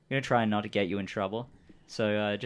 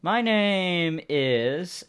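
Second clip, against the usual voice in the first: higher-pitched, drawn-out hesitant character voice; stalling stranger; 8 semitones higher, 4 dB louder, much slower than usual. Acted. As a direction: slowly stall while inventing a name. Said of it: "he's looking around".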